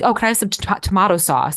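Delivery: lightly imitated Australian accent, tomato said tom-AH-to; a hint of Aussie, tomato with an ah vowel; mock Australian accent. Ordering sauce abroad in a light mock Australian accent. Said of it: "I would put on an accent".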